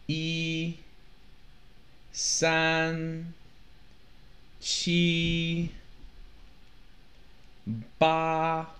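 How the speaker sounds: "一、三、七、八" each held high and even; high level first tone. Shown as a demonstration. Teacher drilling first-tone numbers, high and level. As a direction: sustain each of "一、三、七、八" without any glide. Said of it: "the first tone".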